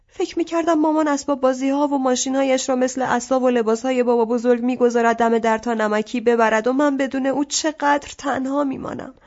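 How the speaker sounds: plain reading voice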